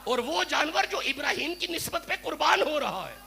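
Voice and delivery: builds to a full shouted climax; rising sermon roar; fiery preaching. Real, not acted.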